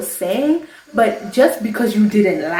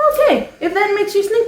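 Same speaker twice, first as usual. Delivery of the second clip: sarcastic dismissal; 11 semitones higher, 4 dB louder than usual. Real, not acted. Sarcastic jab at commenters.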